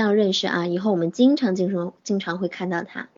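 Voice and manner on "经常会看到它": ordinary talk, nothing marked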